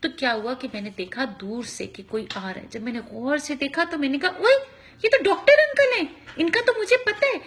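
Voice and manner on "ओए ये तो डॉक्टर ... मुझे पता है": put-on excited child. Child recognizing doctor uncle.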